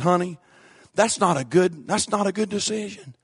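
low, soft pastor's counsel; quiet imitation of the preacher. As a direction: say it gently and low.

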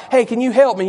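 normal talking voice

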